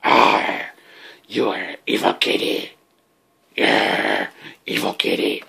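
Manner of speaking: low, breathy mock-villain voice; mock sinister. Owner teasing the biting kitten in a mock-villain whisper. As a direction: hiss it like a playful bad guy. Owner teases cat in creepy voice.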